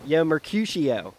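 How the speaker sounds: street-corner Delco take on Shakespeare; Delco accent. Acted. Calling Mercutio over about the thumb-biting, Delco accent. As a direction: play it as a Delco guy calling out a rival. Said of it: "say in a Delco accent".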